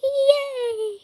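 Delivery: silly cheers rising into a squeaky high pitch. Pretend. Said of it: "Why is your voice changing?".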